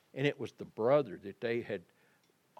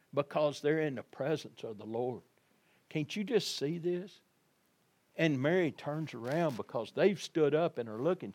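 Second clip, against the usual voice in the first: ordinary talk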